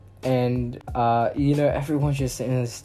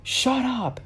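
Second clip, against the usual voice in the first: much higher mock shut-up from the lady; mock snapped order; 12 semitones higher than usual. Masked lady silencing the boy, higher mock order. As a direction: cut him off like the masked lady. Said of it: "And she's like".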